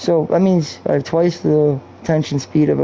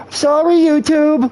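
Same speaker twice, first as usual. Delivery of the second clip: high, slow, sarcastic sorry; sing-song fake apology to YouTube; drawn-out, high sarcastic apology; mock apology; 10 semitones higher, slower than usual.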